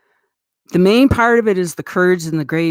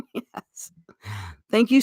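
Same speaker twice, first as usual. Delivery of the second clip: normal talking voice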